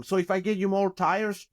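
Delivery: ordinary talk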